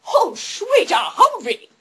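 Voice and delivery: high, excited mock Scottish exclamation; Sean Connery. Star in the background spots a Humvee, gleeful high impression. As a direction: whoop it in a slurred celebrity impression. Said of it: "Sean Connery's in the background like".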